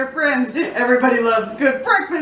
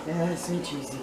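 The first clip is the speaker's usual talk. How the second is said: much softer, lower; 7 semitones lower, 13 dB quieter than usual. Real, not acted. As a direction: drop to a soft low murmur on "so cheesy". Repeats it quietly, savouring.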